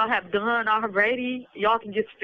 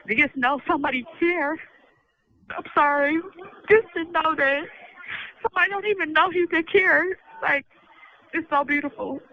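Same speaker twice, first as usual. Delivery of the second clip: higher, halting, tearful voice; real, choked-up emotion; 6 semitones higher than usual. Real, not acted. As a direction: overcome with gratitude, pausing to hold it together. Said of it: "I'm just emotional".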